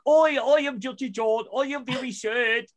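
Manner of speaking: high, loud mock British accent; mocking a thick British accent. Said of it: "super extra thick accent".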